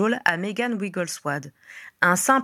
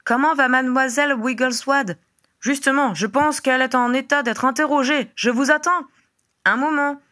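raised, higher-pitched exchange; calling across; 6 semitones higher, 3 dB louder than usual. A narrator's reading. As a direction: project it as if through a fireplace. Conversation criée à travers la cheminée. (échange à voix haute et aiguë, lancé de loin)

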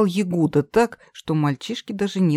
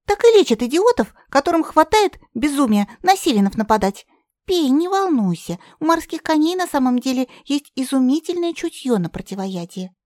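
firebird scolding then soothing; high bird voice; 9 semitones higher than usual. A narrator's reading. Zharusya lectures Katya. (высокий птичий голос)

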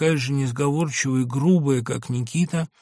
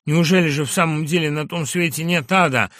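much higher, loud hoarse yell; patient hurling himself at the door; hoarse furious cry; 5 semitones higher, 9 dB louder than usual. Acted. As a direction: choke out a desperate scream. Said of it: "крикнул он сиплым голосом".

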